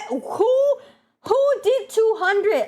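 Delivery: high, stretched-out disbelief; incredulous outcry. Real, not acted. Streamer asking who redeemed two hundred burps, incredulous high cry. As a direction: demand to know who, pitch leaping up.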